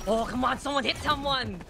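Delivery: ordinary delivery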